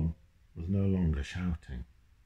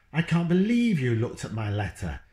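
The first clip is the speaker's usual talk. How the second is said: angry dad; 5 semitones higher, 4 dB louder than usual. Acted. Parents arguing, Dad's line.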